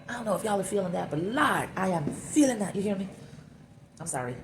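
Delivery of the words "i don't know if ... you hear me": higher, lively put-on Jamaican lilt; imitation Jamaican patois, pitched up; Jamaican accent. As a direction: bust into a playful Jamaican accent. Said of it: "I don't know why I bust into Jamaican".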